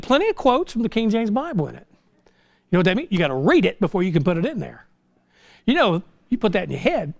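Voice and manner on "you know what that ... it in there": normal talking voice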